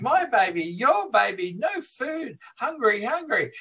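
high, pleading begging woman; imitating a frantic mother begging for food. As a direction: mock-desperate plea, voice climbing high. Woman begging with her baby.

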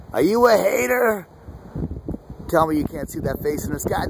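ordinary delivery

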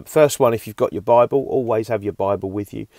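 usual speaking voice

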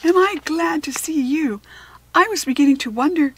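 high, loud joyful cry of his name; relieved girl's happy cry; glad cry. Acted. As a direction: call out to him, thrilled. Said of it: "cried Harriet".

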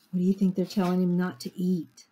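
plain reading voice

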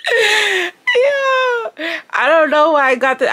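high laughing yeah. Real, not acted. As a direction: squeal it through laughter. Still laughing after outburst.

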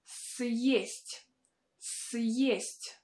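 Съесть with y and e blended, syest; y and e combined. Shown as a demonstration. Teacher modelling Съесть, y and e combined. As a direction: say Съесть as s-yest, y plus e. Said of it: "pronounce it with a combination of sounds y and e".

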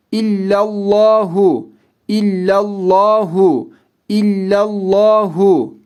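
illallahu with a heavy, dark lam; thick lam. Shown as a demonstration. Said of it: "Kalın".

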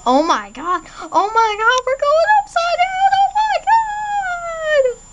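high excited shrieks of oh my god; on a roller coaster. Pretend. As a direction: squeal like riding a loop. Screaming on upside-down virtual coaster.

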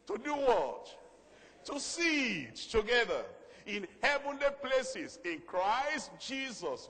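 high, loud call to the congregation; preacher driving the verse home; rousing preaching. Real, not acted. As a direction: proclaim the verse slowly and forcefully. Preaches at full force.

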